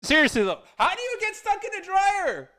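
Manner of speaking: high, disbelieving squeal; incredulous. Real, not acted. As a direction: ask it in total disbelief. Incredulous at silly excuse.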